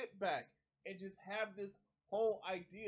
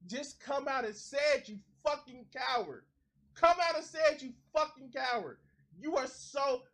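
loud, higher furious demand; angry yell; 6 semitones higher, 7 dB louder than usual. Real, not acted. Streamer yelling at the guest to admit it, angry shout. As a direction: yell it at him, much louder.

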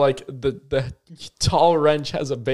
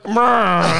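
trombone noise; 6 semitones higher, 4 dB louder than usual. Retold or imitated. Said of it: "and you're like".

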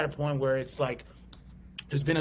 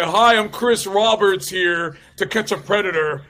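louder, announcer-style TV host intro; TV host impression; 5 semitones higher, 12 dB louder than usual. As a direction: play the hidden-camera show host. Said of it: "that show on N B C where it's like".

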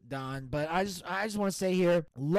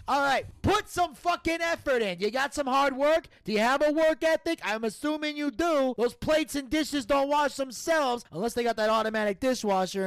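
higher, insistent Italian-American mobster; ranting mob Don; 7 semitones higher, 4 dB louder than usual. Acted. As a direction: chew out a lazy underling as the boss.

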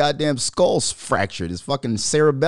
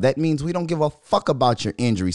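normal talking voice

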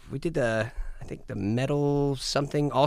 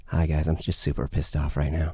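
mock gentle [name]; 8 semitones lower than usual. [name] speaks gently while claiming anger, deep and mild. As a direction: say the angry words sweetly. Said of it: "he's got the nicest voice".

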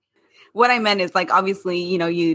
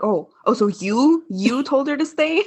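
higher-pitched disbelief, rising on each question; incredulous; 7 semitones higher than usual. Acted. Parents hearing who told her to stay, incredulous. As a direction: question it in mock outrage.

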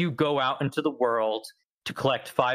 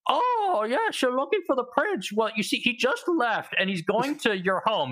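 retold old man; 10 semitones higher than usual. Old man telling the player the prince left, high mock voice. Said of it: "there's an old man".

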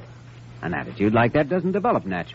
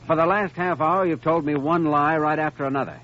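raised, pressing confrontation; firm doctor pushing hard to break her down; stern accusation; 7 semitones higher than usual. Acted. Doctor confronts lying girl.

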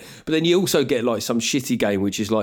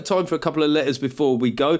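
normal talking voice

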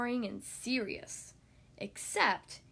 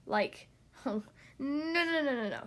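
plain reading voice

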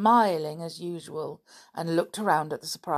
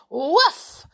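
high, loud happy woof; one gleeful yap; excited dog woof; joyful bark; 12 semitones higher, 10 dB louder than usual. Acted. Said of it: "said Timmy joyfully".

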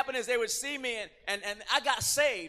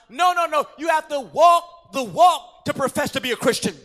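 loud, higher forceful preaching; full-force preaching; 4 semitones higher, 8 dB louder than usual. Acted. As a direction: hammer the point home. Preacher breaks it down.

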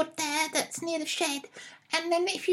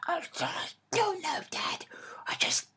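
whispered sulk; 7 dB quieter than usual. Acted. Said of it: "I'm feeling really angry".

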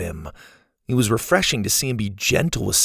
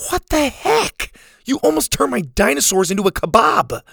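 indignant; 14 semitones higher, 3 dB louder than usual. Acted. Character scolds after the spear jab.

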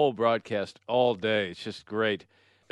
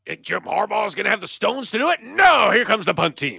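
higher, excited radio play-by-play call; Greg Sharp impression; 8 semitones higher, 5 dB louder than usual. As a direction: ham up the homer radio announcer. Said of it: "This is Greg Sharp".

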